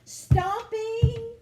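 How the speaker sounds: loud, high stomping word; a big booming read-aloud stomp. A narrator's reading. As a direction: stamp the word out. Stomping colours in the noisy paint box.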